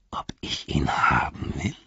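breathless whisper, words barely voiced; a greedy orc hardly daring to ask; whispered disbelief. A narrator's reading. Orc whispers to the hobbits, greedy and unbelieving.

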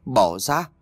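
gruff angry snap, a changed voice; eerie old man snapping at his wife; snapping angrily. Acted. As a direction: bark at her to let go. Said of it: "ông bỗng gắt lên rồi mắng".

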